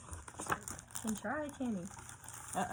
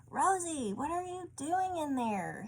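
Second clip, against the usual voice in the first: yelling; 7 semitones higher than usual. A narrator's reading. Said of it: "he yelled".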